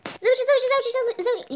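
high loud repeated call; wife calling her husband, loud and high. Acted. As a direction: shout for the husband from afar. Wife calls husband urgently.

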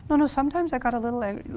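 downplay it quickly; sheepish mother. Retold or imitated. Mother admitting her anger, high and fast. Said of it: "You can level with me".

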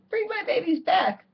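high, pleading cry for her babies; a frantic mother calling after her ducklings; mother duck begging for her ducklings back. Acted. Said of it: "she cried".

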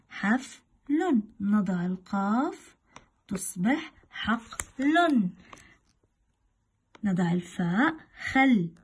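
usual speaking voice

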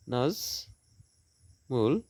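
"Nazmul" very slowly as নাজ, pause, মুল; two stretched beats in "Nazmul"; syllable by syllable. Shown as a demonstration. Teacher dividing a name for students, "Nazmul" slowly split in two. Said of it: "দুটি syllable".

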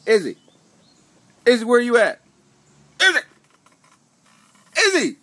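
loud, high call for a pet. Real, not acted. Owner looking for the other dog, calling out. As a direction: call sharply across the house.

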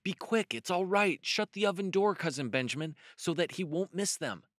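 high, hurried-sounding rabbit voice; high-pitched storybook urging; urgent coaxing. A narrator's reading. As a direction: press a hesitant cousin in a high voice. Peter pushes hesitating Benjamin on.

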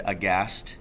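plain reading voice

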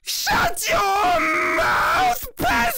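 shrill high yell; shouting. Pretend. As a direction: scream it at the peasant. Loyal subject yelling for king.

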